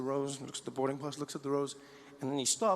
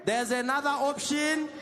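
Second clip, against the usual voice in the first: South African specialist, slow; 9 semitones higher, slower than usual. Acted. Specialist slowly tempting the suspect with a drink. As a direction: tempt him out with a cold drink.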